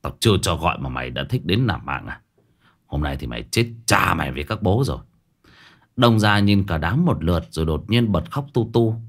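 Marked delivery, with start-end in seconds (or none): 0.0-5.0 low, fast menacing growl; a master's low threat. A narrator's reading. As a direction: grind the words out low at him. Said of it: "Cậu gằn giọng".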